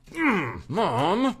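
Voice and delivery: high-pitched whine at Mom; mock childish whine. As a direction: protest like a kid sent out of the room. Child protesting being sent out.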